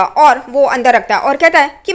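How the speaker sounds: ordinary delivery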